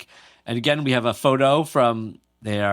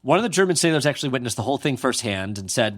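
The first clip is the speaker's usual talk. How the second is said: ordinary talk, nothing marked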